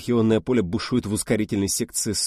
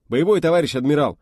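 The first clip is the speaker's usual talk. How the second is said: high, loud soldierly bark; barking reply; 7 semitones higher, 8 dB louder than usual. Acted. Officer answering the commander-in-chief, loud bark. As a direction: roar it like an eager trooper. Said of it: "зарявкал Киврин".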